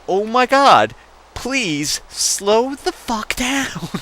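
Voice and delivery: high, drawn-out begging; desperate plea. Retold or imitated. Terrified passenger begging sister, high slow plea. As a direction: beg her slowly to ease off. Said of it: "it's like".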